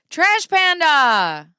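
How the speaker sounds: loud, slow, drawn-out name call; excited shout-out. Real, not acted. Welcoming viewer Trash Panda, loud drawn call. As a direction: greet her with a big cheer. Greeting chatter loudly.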